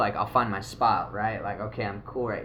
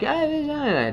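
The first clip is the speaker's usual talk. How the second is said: much higher, singsong imitation of a woman; high squeaky woman voice; 4 dB louder, slower than usual. Imitating a woman at the rave, high and chattering.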